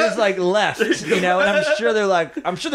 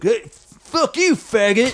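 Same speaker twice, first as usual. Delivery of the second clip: higher, drawn-out jeer; mock heckler's yell; 6 semitones higher, slower than usual. Storyteller imitating a heckler's insult, mock yell. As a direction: toss out a slow, high-pitched taunt.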